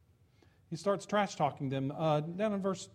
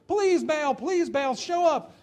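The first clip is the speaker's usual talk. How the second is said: high, loud pleading cry; desperate begging; 9 semitones higher, 7 dB louder, slower than usual. Acted. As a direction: beg the idol in despair. Prophets begging Baal desperately.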